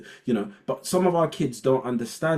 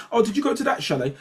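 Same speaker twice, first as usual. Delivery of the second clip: raised posh society voice; high posh gushing; 12 semitones higher, 4 dB louder, faster than usual. Retold or imitated. Interviewer chatting about skiing in a high posh voice. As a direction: ask like a well-off skier showing off. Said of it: "it's like".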